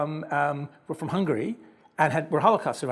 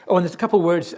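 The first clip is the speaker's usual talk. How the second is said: ordinary delivery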